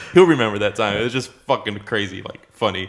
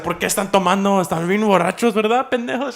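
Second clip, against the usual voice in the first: scolding grandma; 8 semitones higher than usual. Retold or imitated. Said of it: "talking shit about it".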